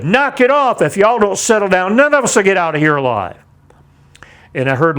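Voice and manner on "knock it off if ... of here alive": high, loud yell to silence the crowd; retold scream. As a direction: shout them down to stop the panic. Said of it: "I finally screamed as loud as I could".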